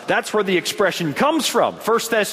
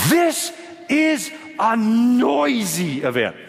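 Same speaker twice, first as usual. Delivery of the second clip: "This is a noisy event" slowly spaced word by word; emphatic declaration; 4 semitones higher, much slower than usual. Real, not acted. Preacher sums up for congregation, "noisy" slow and emphatic. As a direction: draw out "noisy" in a measured proclamation.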